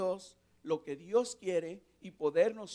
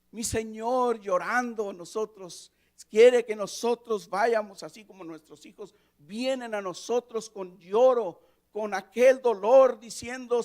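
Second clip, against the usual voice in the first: louder, rising preacher's cry; fervent sermon climax; impassioned preaching; 6 semitones higher, 12 dB louder than usual. Acted. Preaching at full force.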